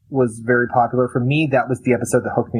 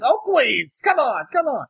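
mock scoff; 13 semitones higher, slower than usual. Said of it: "when they're talking about how tough Rick is".

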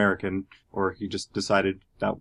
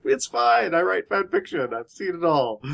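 put-on breezy teen; 9 semitones higher than usual. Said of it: "she's like".

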